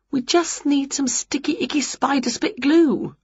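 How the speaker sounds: high, relishing character voice; Winnie, scheming. A narrator's reading. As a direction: savour each icky word up high.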